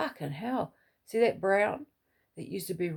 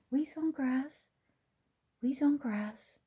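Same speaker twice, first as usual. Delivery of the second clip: high sing-song potty command; coaxing a puppy; 7 semitones higher, slower than usual. Retold or imitated. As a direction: lilt it like talking to a puppy. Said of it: "she remembers that".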